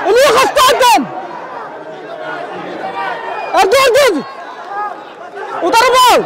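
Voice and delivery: urgent shouts from the commentary seat; excited play-by-play. Real, not acted. Commentator cheering on a wrestler mid-bout, excited play-by-play.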